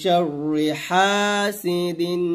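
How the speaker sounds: drawn-out chanted শাররি হাসিদিন; slow recitation. Shown as a demonstration. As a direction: stretch it slowly like recitation. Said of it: "আবার একসাথে".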